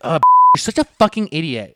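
high, loud mock scolding; retold nagging. Girlfriend nagging her boyfriend, loud exasperated scolding. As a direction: scold him like a fed-up girlfriend. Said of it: "keep fucking nagging him".

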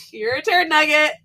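high loud teasing reveal; gleeful taunting. Retold or imitated. Little sister revealing the insulting fortune, loud gleeful taunt. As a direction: gloat over the prank.

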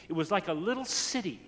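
ordinary delivery

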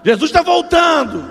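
loud, slow, high cry; shouted proclamation. Real, not acted. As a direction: shout it to the whole church. Shouted repeat, louder.

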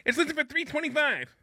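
high, complaining imitation of a pushy realtor; whiny realtor voice. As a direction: realtor yelling at the broker, high mocking voice. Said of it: "Can you do the rest of the show with that voice".

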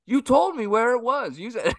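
put-on high accusing kid voice; whiny kid accusing. Uncle retelling the nephew accusing Uncle [name], high and whiny. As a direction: pin the blame on him like a kid. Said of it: "He's like".

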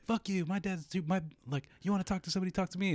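very high imitation of a combative woman; hammed-up high voice; mock challenge. Imitating neighbour girl challenging him.

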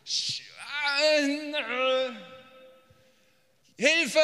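high, loud, strained cry for help; desperate cry. Acted. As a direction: scream it out from distress. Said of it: "Und dann müssen wir sagen". (verzweifelter, lauter Hilfeschrei)

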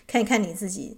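usual speaking voice

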